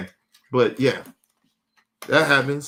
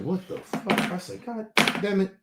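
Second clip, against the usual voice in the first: mock shock; 7 semitones higher, 5 dB quieter than usual. His shocked reaction.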